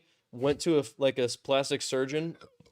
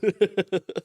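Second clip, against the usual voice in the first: bright burst of laughter; high laugh; 7 semitones higher, 5 dB louder than usual. Real, not acted. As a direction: laugh out loud.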